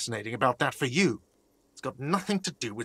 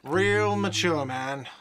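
whiny shout; 3 semitones higher, slower than usual. Acted. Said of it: "a whiny voice shouts".

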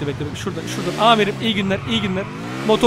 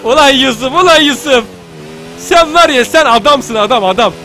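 loud, rising cheer that settles at the end; loud gushing praise; excited praise; 3 semitones higher, 5 dB louder than usual. Real, not acted. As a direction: shout your thanks to a hero.

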